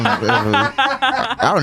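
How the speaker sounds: chuckling through words; talking while laughing. Real, not acted.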